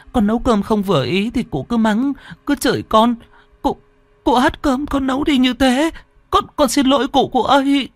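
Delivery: put-on very high, stammering child in distress; startled, distressed girl. A narrator's reading. Startled girl apologises to great-grandmother over spilled rice, distressed high pleading. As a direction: plead in a high, upset girl's voice. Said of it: "Con Lan giật mình".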